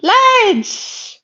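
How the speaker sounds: high, drawn-out exclamation; appalled exclamation. Real, not acted. Streamer calling out the viewers, appalled. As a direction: cry out high in disbelief.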